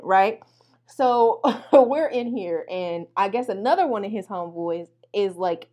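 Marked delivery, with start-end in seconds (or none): none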